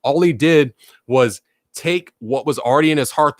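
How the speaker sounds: ordinary delivery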